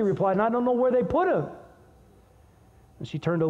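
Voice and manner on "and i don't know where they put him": mildly anguished, higher and hurried; a touch of pleading urgency; anguished Mary. Acted. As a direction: voice Mary's distress, pitch lifted and quick. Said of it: "can you hear the anguish in Mary".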